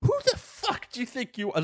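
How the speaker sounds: high-pitched mock outrage; indignant kid. Kid confronting the Pagemaster, mock outrage. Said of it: "the kid's like".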